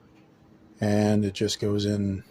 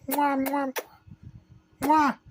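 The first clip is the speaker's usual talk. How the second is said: big wet smooch. Pretend. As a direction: blow big wet kisses. Sending kisses.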